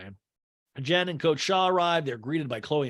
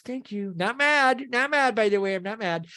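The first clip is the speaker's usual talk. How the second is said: high, insistent mock denial; hammed-up protest, climbing high; Meredith; 7 semitones higher than usual. Meredith calls after the departing women, high imitated denial. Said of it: "And Meredith goes".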